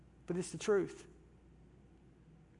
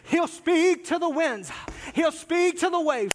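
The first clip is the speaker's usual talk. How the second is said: much louder, higher and slow, hammed-up pulpit voice; mock big-preacher boom; 7 semitones higher, 5 dB louder, slower than usual. Mimicking other preachers, a hammed-up booming Jesus. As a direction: lampoon the dramatic preacher who booms at the storm. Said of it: "they always do it like that".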